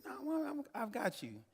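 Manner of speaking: put-on a small boy insisting he'll stay with mom; insistent child voice.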